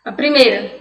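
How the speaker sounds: normal talking voice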